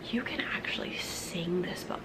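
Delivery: put-on hushed, breath-only confiding to children; secretive whisper. Teacher lets the class in on a secret, whispering.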